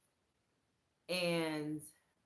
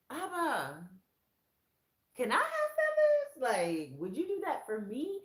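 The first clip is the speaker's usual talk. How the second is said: eager plea; 10 semitones higher, 7 dB louder than usual. Retold or imitated. Speaker retelling prayer to God.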